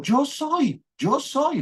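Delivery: voicing the Lord. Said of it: "le dice el Señor Jesús".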